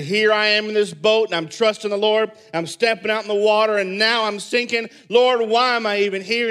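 a frustrated outburst at God; mad complaint. Pretend. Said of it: "could have gotten really mad and said".

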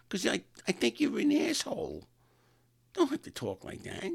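very high impediment voice; imitated woman's high, slurred speech; harelip date. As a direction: play an offended woman with a cleft lip. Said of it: "talking like me for".

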